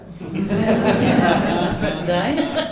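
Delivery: short real laugh; amused laugh. Real, not acted. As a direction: laugh along with the class. Teacher laughs at the class.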